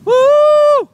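high whooping cheer; loud whoop. Real, not acted. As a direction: let out a big woo. Cheering kid on bike.

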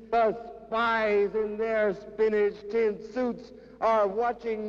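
declaimed poem, climbing to a high, ringing pitch; dramatic recital with rising intensity; impassioned poetry reading. Acted. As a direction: start measured, build to fervent high-pitched recitation.

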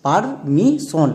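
Permission very slowly in parts, par... mi... shon; syllable by syllable. Shown as a demonstration. Teacher has pupils read along, permission slowly syllable by syllable. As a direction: sound out permission one stretched syllable at a time. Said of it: "একসঙ্গে উচ্চারণ করো".

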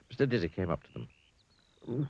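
ordinary delivery

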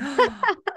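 a sharp, high delighted gasp; excited gasp. Real, not acted. Host gasps at the guest's dance answer, delighted.

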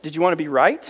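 usual speaking voice

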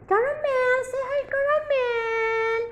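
high sing-song pet voice; baby talk to a pet. Acted. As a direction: coo at the kitten. Talking to new kitten.

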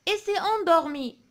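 very slow, projected reading; poem read aloud. Shown as a demonstration. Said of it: "نقراو الشعر هذا مع بعضنا".

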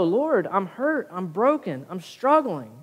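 praying into the pillow, hurt and broken; mock tearful prayer. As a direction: pour out your hurt to God. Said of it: "Cry on your pillow".

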